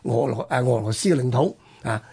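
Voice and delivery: ordinary delivery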